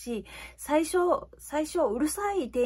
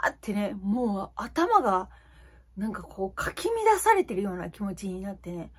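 normal talking voice

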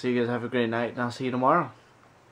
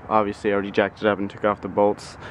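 ordinary delivery

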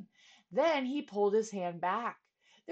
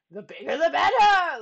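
laughing; 5 semitones higher, 12 dB louder than usual. Acted. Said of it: "laughed the king".

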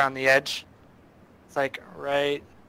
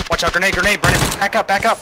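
urgent shouted warning, higher; grenade warning yell; 5 semitones higher, 10 dB louder than usual. Real, not acted. Grenade thrown, warning teammate.

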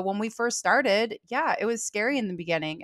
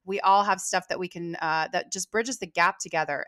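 normal talking voice

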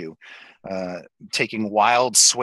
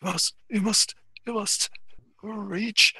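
eerie high-pitched plea; otherworldly character voice; 10 semitones higher than usual. Acted. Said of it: "He says".

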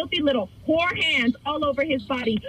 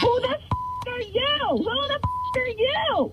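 real outraged demand, pitched much higher; furious; 9 semitones higher, much slower than usual. Real, not acted. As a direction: explode at the stranger on the line. Jealous girlfriend confronts the secretary.

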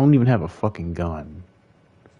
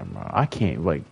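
ordinary talk

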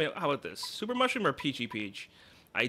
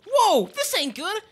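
high, loud, slow alarm; 8 dB louder than usual. Acted. A townsperson panics about the pig mayor in a high loud voice. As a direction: gasp it out in shock.

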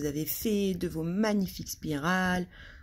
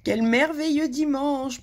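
gleeful thought; 8 semitones higher, 4 dB louder, slower than usual. Acted. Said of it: "pensait Madame Canaille".

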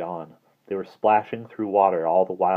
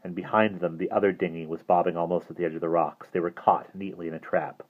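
ordinary delivery

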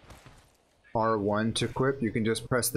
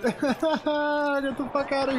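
laughing; slower than usual. Real, not acted. Laughing after a lucky kill.

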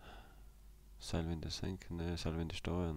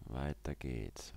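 slow, drawn-out call with a falling end; 2 semitones lower, much slower than usual. Real, not acted. Player urging himself onward, slow and drawn out. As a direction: stretch "Weiter" long and let it fall. Moving on in the game.

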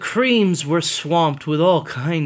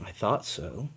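smiling; 5 semitones lower, 9 dB quieter than usual. Acted. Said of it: "said with a smile".

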